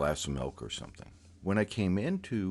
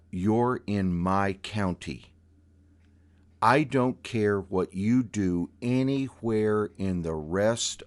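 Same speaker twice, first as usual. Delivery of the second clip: slow and louder, laying down the law; stern warning; 4 dB louder than usual. Retold or imitated. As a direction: spell out the rules like a hard judge. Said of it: "probably dropped a couple octaves".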